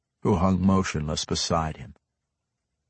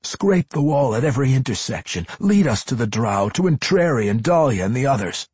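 urgent, raised directions; desperate pressing instructions; Drizzt; 7 semitones higher, 7 dB louder, faster than usual. A narrator's reading. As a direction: rattle out the plan higher and louder. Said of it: "voice raising in a bit of desperation".

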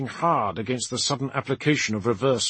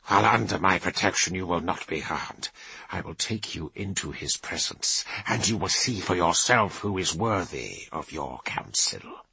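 starts hard, then sinks low and hushed; firm rebuke; 5 semitones lower than usual. Acted. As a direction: round on him, then drop to a low vow. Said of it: "Kavar rounded on him".

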